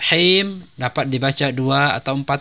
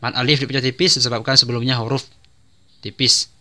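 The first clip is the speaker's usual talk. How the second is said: ordinary talk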